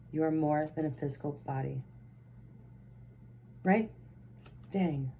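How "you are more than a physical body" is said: meditative read. Shown as a demonstration. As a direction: let each word of the line settle gently. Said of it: "So again".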